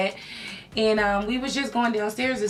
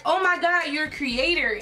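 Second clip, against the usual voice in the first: higher, excited gasp of recognition; delighted surprise; 6 semitones higher than usual. Retold or imitated. Michelle spotting the creator badge, higher and delighted. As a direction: imitate a delighted discovery. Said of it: "She was like".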